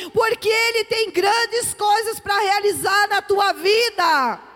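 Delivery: shouted, high, drawn-out proclamation; full-force preaching. Real, not acted. Preacher declaring a miracle to the congregation, impassioned shouting. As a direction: proclaim it at the top of your voice, stretching each phrase.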